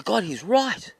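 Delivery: high, loud dawning astonishment; sudden realisation. Acted. Old man hearing the rooster's advice, astonished. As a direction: exclaim as the truth hits.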